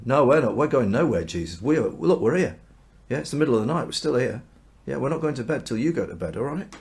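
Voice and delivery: insistent protest. Retold or imitated. Disciples telling Jesus they are going nowhere, insistent protest.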